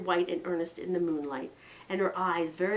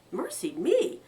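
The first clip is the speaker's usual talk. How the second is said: shocked; 12 semitones higher, 5 dB louder, slower than usual. Acted. Young mother's shocked exclamation.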